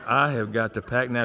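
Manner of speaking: low, fuller-voiced mock congregant; imitated sarcasm. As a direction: spell out "I have got" in mock sarcasm. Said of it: "a lot of that sarcasm is coming out".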